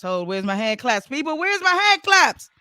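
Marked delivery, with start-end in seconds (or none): 1.1-2.5 demanding applause. Real, not acted.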